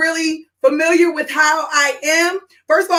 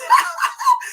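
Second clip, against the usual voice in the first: a sudden high squeal of a laugh; shocked laugh burst. Real, not acted. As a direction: let a startled laugh jump out high. Shock at winning award.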